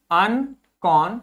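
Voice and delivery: अन कौन said slowly, one syllable at a time; spelling it out slowly. Shown as a demonstration. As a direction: break अन कौन into slow separate parts. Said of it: "तोड़ तोड़ के पढ़ते हैं". (शब्द को धीरे-धीरे तोड़कर)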